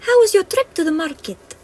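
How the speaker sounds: cheery little fairy asking. Acted. Fairy asks.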